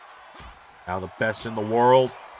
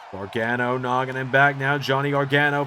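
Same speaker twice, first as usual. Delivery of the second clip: ordinary delivery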